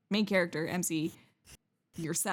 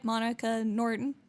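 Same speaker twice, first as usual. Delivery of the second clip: ordinary talk, nothing marked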